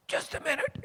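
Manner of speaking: high, squeezed put-off voice; imitated strained reply. Man busy with a needle putting off donut giver, imitated strain. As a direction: mimic someone busy, holding off an interruption. Drug user interrupted mid-injection, imitated.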